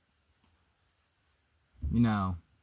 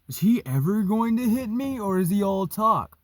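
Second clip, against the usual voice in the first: high, louder taunting challenge; mocking taunt; 10 semitones higher, 4 dB louder than usual. Orangey goading the narrator to throw a punch, high mocking taunt. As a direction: goad him in a raised, mocking voice. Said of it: "and he goes".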